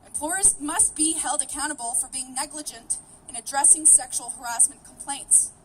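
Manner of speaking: usual speaking voice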